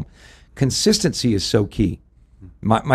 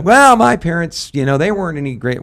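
mock excuse-making; 8 semitones higher, 5 dB louder than usual. Acting out a grown child's excuse.

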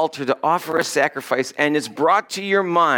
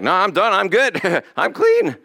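mock relieved; 5 semitones higher than usual. Mock relief after brushed-off apology.

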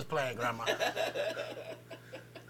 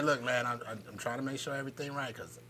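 much softer, low, careful warning; soft serious tone; 7 semitones lower, 16 dB quieter than usual. Retold or imitated. Warning the daycare teacher in a soft, creepy tone. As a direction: say it low and measured so it lands. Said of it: "Are you talking in that tone?".

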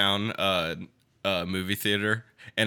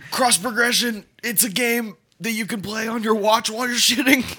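mock pitch; 10 semitones higher than usual.

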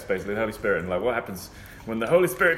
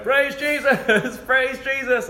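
high, slow drawn-out praise; hammed-up worshipper praising Jesus; mock fervent praise; 13 semitones higher, 4 dB louder, much slower than usual. Imitating man praising.

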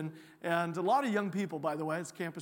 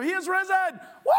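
even louder joyful call; rally the crowd once more; shouted call; 12 semitones higher, 9 dB louder than usual. Shown as a demonstration. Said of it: "let's all do it together one more time".

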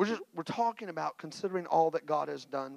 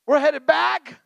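loud, raised preaching with mock frustration at God; preaching at full force; 11 semitones higher, 12 dB louder than usual. Preacher telling the church how he complained to God, loud preaching.

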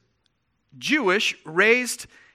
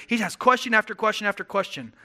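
ordinary talk, nothing marked

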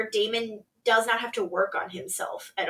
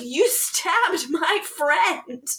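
slow, higher indignant protest; hammed-up accusation, drawn out; mock outrage; 5 semitones higher, much slower than usual. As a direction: imitate an indignant friend. Retold quarrel, outraged protest.

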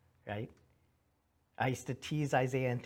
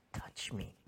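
whispered invitation; 3 semitones lower, 6 dB quieter than usual. Acted. Jesus invites touch, whispered.

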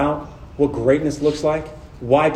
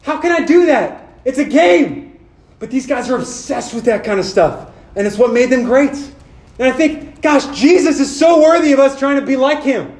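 higher, louder, driving preacher's voice; full-force preaching; 7 semitones higher, 4 dB louder than usual. Acted. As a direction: preach it out to the room, rising and urgent.

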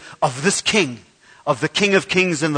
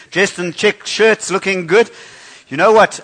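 ordinary talk

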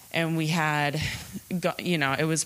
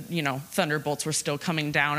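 ordinary delivery

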